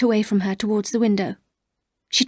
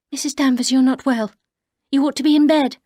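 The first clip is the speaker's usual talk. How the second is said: raised, tense command to rest; alarmed firmness; 5 semitones higher, 5 dB louder than usual. Acted. Narrator to weeping housekeeper, raised and tense. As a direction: urge her to bed, voice lifting. Narrator tells Danvers to stop.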